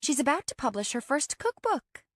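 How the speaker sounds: high-pitched cheerful announcement; bright. A narrator's reading. Friend boasts of the guest's first cookbook, brightly. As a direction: announce it with bubbly pride. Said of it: "Demi told me brightly".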